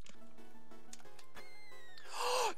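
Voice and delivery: breathy surprised gasp; sharp gasp. Real, not acted. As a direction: gasp in shock. Gasp at discovery.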